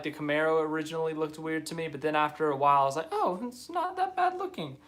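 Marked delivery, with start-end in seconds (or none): 3.1-4.8 much higher-pitched self-quote; retold pleasant realisation. Admitting the new car grew on them, mock pleasantly surprised. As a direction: imitate your own reaction in a high, put-on voice. Retold change of opinion on looks.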